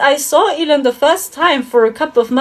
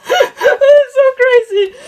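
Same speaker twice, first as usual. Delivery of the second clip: slow, laughing high through it; 10 semitones higher, slower than usual. Real, not acted. Marveling at her dream, slow laughing delivery. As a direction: stretch it out slowly while still laughing.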